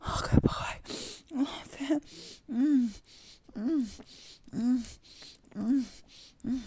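loud, high rising moans; moaning. Acted. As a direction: build into breathless moaning. Intense climax scene.

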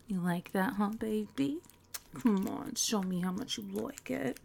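put-on quiet taunting aside to her boyfriend; hushed teasing aside. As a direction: murmur it so the phone can't hear. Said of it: "I was talking to my boyfriend".

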